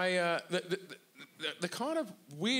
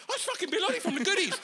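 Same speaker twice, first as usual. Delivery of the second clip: fast, much higher, laughing recognition; fast, high, laughing star-spotting; excited crowd voice; 13 semitones higher, 4 dB louder, much faster than usual. Retold or imitated. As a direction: gush like a starstruck passer-by. Crowd recognises Bill Oddie.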